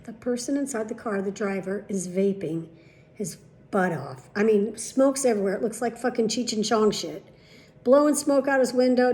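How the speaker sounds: usual speaking voice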